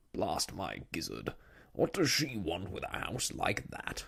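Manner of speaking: put-on gruff, blustery old sea-dog voice; indignant admiral grumbling about a neighbour; blustering admiral. A narrator's reading. Admiral Boom's blustering quote in narration.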